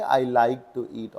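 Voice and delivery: very slow, word by word; slow corrected phrase. Shown as a demonstration. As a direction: dictate the right option. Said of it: "तो ये habit में रखिए". (धीमे सुधार)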